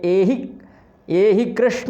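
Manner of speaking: drawn-out calling vowel; called pluta vowel. Shown as a demonstration. Teacher demonstrating a distant call with a long vowel. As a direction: stretch the last vowel as if calling from far. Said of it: "उदाहरण के लिए".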